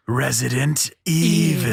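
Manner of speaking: slow, drawn-out "Resident Evil"; dramatic title drop. Acted. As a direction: stretch out "Resident Evil" like a trailer.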